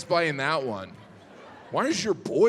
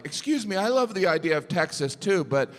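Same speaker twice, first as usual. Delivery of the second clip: pleading peacenik; 5 semitones higher than usual. Retold or imitated. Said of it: "I would've been like".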